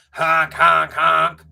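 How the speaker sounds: loud car-horn honks. A narrator's reading. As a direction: blare like a horn. Car honking.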